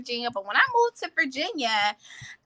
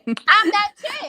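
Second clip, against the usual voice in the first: proud boast; 9 semitones higher, 5 dB louder, much slower than usual. Real, not acted. Proud boast, slow emphatic.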